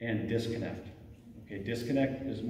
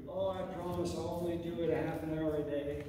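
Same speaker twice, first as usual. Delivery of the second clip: a hint of a pleading child, higher; lightly voiced kid begging for more screen time; bargaining child; 6 semitones higher, 4 dB quieter than usual. Parent imitating a bargaining child, mildly pleading. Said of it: "bargain with you".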